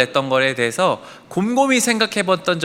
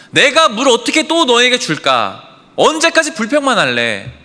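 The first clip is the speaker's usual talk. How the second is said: acted furious rant, pitched high; angry tirade; 6 semitones higher, 4 dB louder than usual. Moses berating the complaining Israelites, angry and high. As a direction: berate them in exasperation, higher and louder. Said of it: "설교단에서 담기 어려운 말로".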